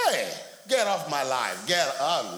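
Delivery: loud, emphatic brush-off; forceful acted send-off; mock dismissal. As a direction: throw them out with playful force.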